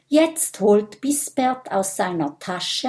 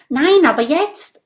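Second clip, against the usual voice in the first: brisk announcement; 8 semitones higher, 6 dB louder than usual. Acted. Vampire answers nervous dragon.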